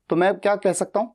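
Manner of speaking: ordinary talk, nothing marked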